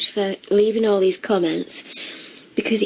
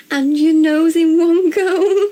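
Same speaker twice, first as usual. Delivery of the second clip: laughing; 9 semitones higher, 4 dB louder, slower than usual. Real, not acted. Rereads the line, laughing.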